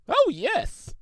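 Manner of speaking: high eager pony chirp; cheerfully. A narrator's reading. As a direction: chirp it happily. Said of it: "Shimmer said cheerfully".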